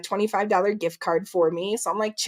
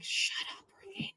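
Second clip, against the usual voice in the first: hushed aside; 12 semitones higher, 14 dB quieter than usual. Retold or imitated. Mom hushing daughter at booth.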